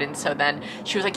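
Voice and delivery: ordinary delivery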